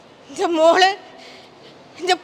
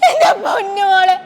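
crying out, louder; wailing sob; 3 semitones higher, 3 dB louder than usual. Acted. Mother cries over her hurt little girl, wailing. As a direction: sob her name out in grief.

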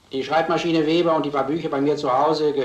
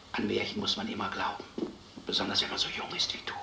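soft, gentle reassurance; 4 semitones lower, 8 dB quieter than usual. Acted. Doctor speaks gently to the girl.

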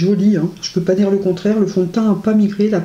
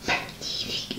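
whispered, breathless admiration; awed whisper; 15 dB quieter than usual. Real, not acted. Vlogger sees the lipstick on her lips, whispering. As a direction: breathe "Magnifique" out in awe.

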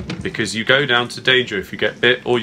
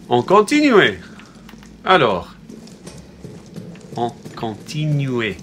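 hammed-up, badly faked French; terrible French accent; slower than usual. Player moving on through the game, terrible mock-French accent. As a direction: put on a deliberately bad French accent. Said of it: "Terrible accent".

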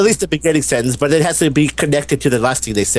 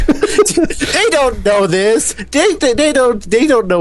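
laughing; 9 semitones higher, slower than usual. Real, not acted. Teasing, laughing confession.

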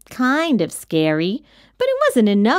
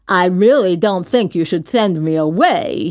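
lower, slobbery monster voice; warning; 4 semitones lower, 4 dB louder than usual. A narrator's reading. Rejected monster to the kid, warning. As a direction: warn ominously in the monster voice. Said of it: "he warned".

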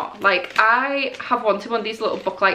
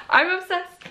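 excited; 8 semitones higher than usual. Real, not acted. Excited about book lamp.

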